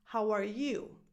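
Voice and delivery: YOU stressed as the last word. Shown as a demonstration. Coach showing learners the wrong stress, YOU stressed. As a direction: put the weight on "you", not "are". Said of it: "So this one is not pronounced".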